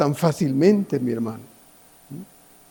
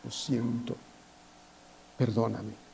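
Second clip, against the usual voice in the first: softer, lower, near-tearful plea; tearful prayer; 7 semitones lower, 14 dB quieter, slower than usual. Retold or imitated. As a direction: murmur it like a penitent on the edge of tears. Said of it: "hasta con lágrimas le decimos".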